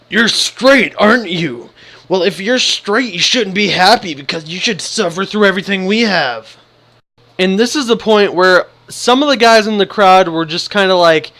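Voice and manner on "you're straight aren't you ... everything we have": mock angry. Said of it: "He was so mad".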